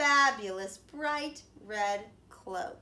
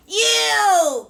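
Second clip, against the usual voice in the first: very loud high "You!"; pouncing shout; 12 semitones higher, 15 dB louder than usual. A narrator's reading. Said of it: "cried the wolf".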